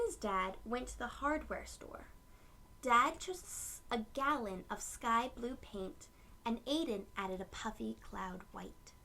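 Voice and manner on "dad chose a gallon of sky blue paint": plain reading voice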